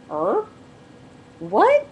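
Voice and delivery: rising, incredulous questions; high, loud double take; retold disbelief. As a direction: blurt it out, taken aback. Retold disbelief at husband's request.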